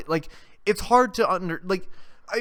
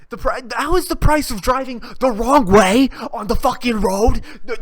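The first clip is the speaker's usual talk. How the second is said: loud, incredulous outburst; worked-up loud disbelief; outraged rant; 3 semitones higher, 6 dB louder than usual. Real, not acted. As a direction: belt it out in comic exasperation. Ranting about protesters jumping on cars.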